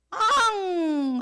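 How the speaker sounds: long, loud high mock wail; drawn-out imitation of bawling; imitated child's cry. Teacher mimics how Sua often cries, loud mock wail. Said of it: "하고 우는 게 수아는".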